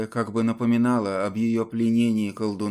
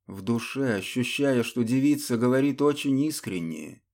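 ordinary delivery, nothing marked

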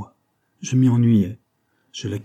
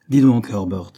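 raised-voice hail, lightly acted; calling out; 7 dB louder than usual. A narrator's reading. Rosa hailing her brother Herbert, calling out. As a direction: call to your brother, a bit louder. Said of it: "cria Rose".

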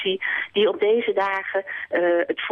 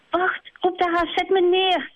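much higher, scared hare calling out; panicking hare; 12 semitones higher than usual. Acted. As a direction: cry out to be put down. Haas panicking in flight.

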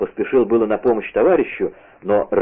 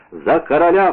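higher, moderately raised rallying cry; echoed battle cry; 6 semitones higher than usual. A narrator's reading. Raoul echoing D'Artagnan's cry for king, raised call. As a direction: echo a comrade's cry. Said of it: "вторил ему Рауль".

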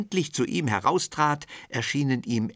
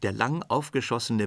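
usual speaking voice

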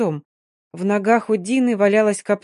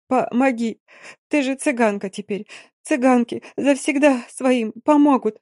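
stammering, sobbing plea rising higher and louder; hysterical pleading; 5 semitones higher, 4 dB louder than usual. Acted. Yulka begging Mary on her knees, hysterical sobbing plea. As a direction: beg through tears until it breaks. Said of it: "У Копчонки началась истерика ... со всхлипами и срывами".

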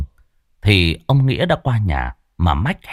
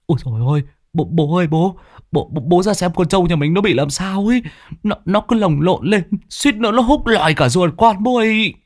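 panicked stammer, much higher and rising; 9 semitones higher than usual. Acted. Son stammers to his father about the buffalo, panicked and high. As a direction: blurt it out, terrified and tripping over words. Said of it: "lắp bắp".

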